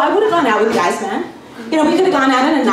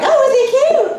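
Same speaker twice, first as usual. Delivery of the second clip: much higher gushing coo; cooing mom voice; 13 semitones higher than usual. Retold or imitated. Mom friend cooing over the Bronze Age baby, high gush.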